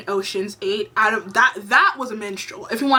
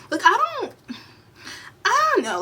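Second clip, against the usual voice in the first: high-pitched, at a loss for words; exasperated disbelief; 11 semitones higher than usual. Real, not acted. Vlogger lost for words over the hosts' remarks, exasperated. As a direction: squeak it out, breaking off in exasperation.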